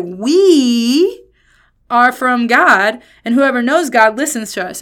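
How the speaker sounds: "We" loud, high and drawn out; heavy emphasis on We. Shown as a demonstration. Preacher reads the last verse with John's emphasis, heavy stress on We. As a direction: belt a long "We", then carry on forcefully. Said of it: "the emphasis that I think".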